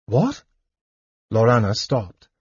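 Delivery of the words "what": high, startled question; pitched-up, stunned disbelief; sudden fear. A narrator's reading. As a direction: ask it with hope turning to alarm. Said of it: "fear replacing the hope in her heart".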